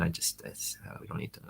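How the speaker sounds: trailing off into a near whisper; fading embarrassed mumble. Retold or imitated. Businessman fumbling his kosher excuse to his host, voice fading to a whisper. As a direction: let the excuse die away, unsure.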